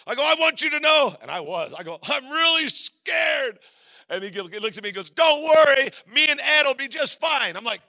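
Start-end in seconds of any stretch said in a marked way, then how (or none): 0.2-1.1 high, loud shout over wind; acted hollering over noise; re-enacted yell. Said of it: "you gotta yell". 2.0-3.6 high, loud, drawn-out holler; comic terrified confession, yelled slowly; re-enacted scared yell. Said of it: "you gotta yell". 5.1-7.5 high, loud jump master yell; acted shout back across the plane; imitated shouted reassurance. Jump master answers over open door.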